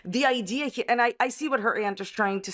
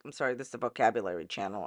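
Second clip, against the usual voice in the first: quick quiet aside; 11 semitones lower, 7 dB quieter, much faster than usual. Acted. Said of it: "running joke aside".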